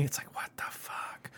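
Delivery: hushed, voiceless aside; whisper. Real, not acted. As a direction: drop to a breathy whisper for the swear. Complaining about paying own bills.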